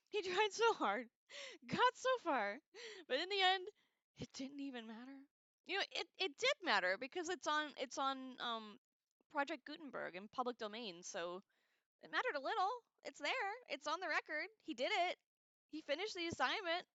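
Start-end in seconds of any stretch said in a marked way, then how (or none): 0.1-3.7 higher, louder mock sympathy; laughing pity for the poor translator; a high, laughing mock-tragic lament. Real, not acted. 12.0-16.9 higher, louder mock comfort; high, bright-voiced consolation in jest; mock reassurance. Real, not acted.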